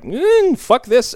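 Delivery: high, loud dismissive grunt and curse; mock fed-up aliens. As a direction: blurt it out as if giving up on the whole thing. Retold aliens fed up, leaving.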